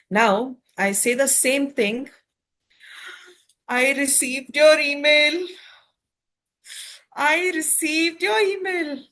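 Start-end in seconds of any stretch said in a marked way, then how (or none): 3.7-5.5 higher, lilting happy take with "your" lifted. Shown as a demonstration. Teacher showing the class happy delight, higher. 7.1-9.1 high, bright-lifted "your", falling gently; light pleased delight at the news; happy. Shown as a demonstration. Teacher demonstrating happy email reply to class, high.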